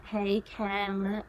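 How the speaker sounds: lightly done Kardashian valley voice; Kardashian impression. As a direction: drawl it like a Kardashian. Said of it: "the girl that impersonates the Kardashians".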